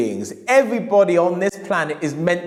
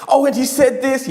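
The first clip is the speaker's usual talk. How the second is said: higher, louder, laughing; amazed whisper-shout; 8 semitones higher, 4 dB louder than usual. Retold or imitated. Customer marvelling at the book's claims, amazed. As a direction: burst out in amazement.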